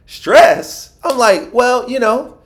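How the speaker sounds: high, loud disbelief; incredulous. Retold or imitated. Answering the doctor about stress, incredulous high yelp. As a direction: squeak out the word in disbelief.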